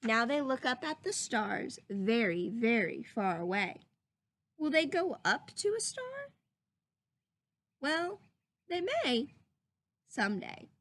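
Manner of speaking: put-on lively storybook reading for children; read-aloud voice. Teacher reading a story to her class, lively read-aloud. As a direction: read it like a picture book to the class.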